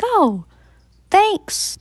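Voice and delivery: high, bright fake gratitude; mock polite thanks. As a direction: brush him off with a chirpy thanks. Fake thanks to creepy stranger.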